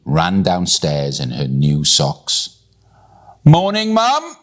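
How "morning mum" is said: high-pitched gleeful greeting; excited scream. A narrator's reading. Millie greeting her mum from downstairs, excited scream. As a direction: call out very high, bursting with energy. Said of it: "she screamed".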